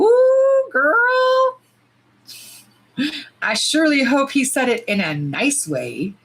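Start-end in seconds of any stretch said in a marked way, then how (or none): 0.0-1.6 pure disbelieving sass. Real, not acted. Reacts to boyfriend's message.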